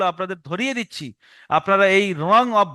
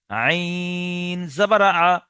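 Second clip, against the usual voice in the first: very slow, long-held আইন; drawn-out spelling drill; chopped-up spelling; much slower than usual. Shown as a demonstration. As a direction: spell it piece by piece, stretching the letter. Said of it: "বানান করার সময় কেটে কেটে".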